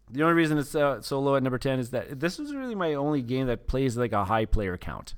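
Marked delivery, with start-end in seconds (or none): none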